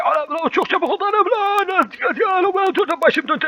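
high panicked yelling down a slide; screaming. Real, not acted. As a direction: shriek while falling. (kaydıraktan inerken çığlık)

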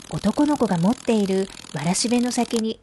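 usual speaking voice

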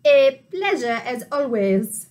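low, put-on duck voice. A narrator's reading. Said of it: "Duck the Duck says".

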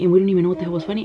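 usual speaking voice

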